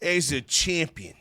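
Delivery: impassioned wrestler addressing the fans, rising in pitch on big claims; wrestler Tony D'Angelo's promo voice. Acted. Wrestler explains himself to doubting fans, impassioned promo voice. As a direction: deliver an emphatic in-ring promo with dramatic pauses.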